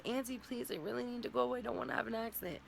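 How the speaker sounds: put-on a small child begging to go now; niece begging. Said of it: "and she's".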